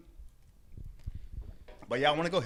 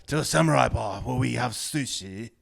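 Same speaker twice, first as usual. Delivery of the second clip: put-on slow, louder old Japanese man accent; lightly voiced old Japanese man; 5 dB louder, slower than usual. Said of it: "I'm horrible at it".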